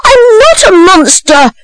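high-pitched frightened denial; terrified. A narrator's reading. Accused boy answering the women, high terrified protest. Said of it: "replied Oliver, terrified".

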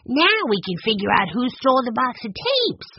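put-on high, eager boy's voice; delighted child detective; beaming. A narrator's reading. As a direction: say it beaming with pride.

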